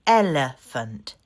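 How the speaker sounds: counting-out "Elephant"; syllable demo. Shown as a demonstration. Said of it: "It still has three".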